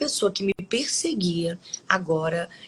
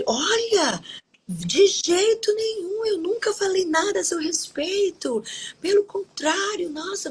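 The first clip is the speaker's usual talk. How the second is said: desperate denial, acted out; 11 semitones higher than usual. Coworker denying she ever spoke ill of Ana, acted desperate. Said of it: "é claro que ela ficou desesperada".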